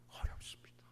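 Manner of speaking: whispered answer. Real, not acted.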